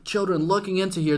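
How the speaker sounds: normal talking voice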